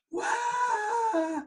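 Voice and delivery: sudden high wailing scream; replaying a raw, high terrified yell; retold scream. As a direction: let out a shrill cry of panic. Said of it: "I just start screaming".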